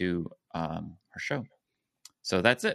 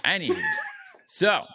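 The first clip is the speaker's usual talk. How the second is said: loud redirect; 3 dB louder than usual. Real, not acted. Reclaiming control after outburst.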